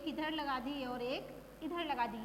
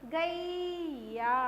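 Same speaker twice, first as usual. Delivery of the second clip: गैया stretched slowly as ga-eee-ya; slow गैया with weight on the first syllable; stressed long ai; 4 dB louder, much slower than usual. Shown as a demonstration. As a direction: hold gai in गैया very long. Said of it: "उस पे जोर देकर बोलना".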